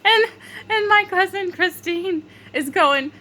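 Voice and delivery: high squeal ending in wheezy laughter; laughing while talking. Real, not acted. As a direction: push the words out through giggles. Laughing fit while recalling cousin.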